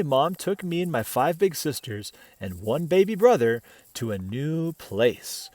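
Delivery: plain reading voice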